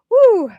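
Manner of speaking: high, loud "woo" of release; sharp exhaled whoop; emotional whoop. Real, not acted. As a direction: let out a big overwhelmed woo. Letting out emotion after gift.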